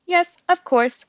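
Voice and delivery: high, loud robot voice; eager-to-comply robot. A narrator's reading. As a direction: answer promptly as a polite robot. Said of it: "said the robot".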